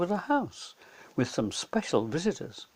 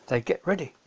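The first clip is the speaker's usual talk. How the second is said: usual speaking voice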